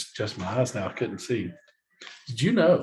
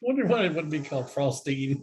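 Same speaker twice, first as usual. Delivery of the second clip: ordinary talk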